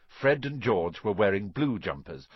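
ordinary delivery, nothing marked

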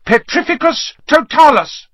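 loud, very high incantation; crying out a spell. A narrator's reading. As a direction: cast the spell with a ringing cry. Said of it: "she cried".

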